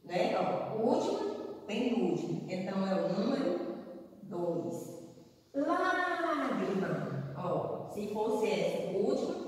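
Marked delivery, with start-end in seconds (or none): 5.5-7.3 Lágrima with LÁ high and held; stress on first syllable. Shown as a demonstration. Teacher showing the antepenultimate is strongest, LÁ drawn out. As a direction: stretch the "Lá" of "Lágrima" slowly. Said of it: "antepenúltima".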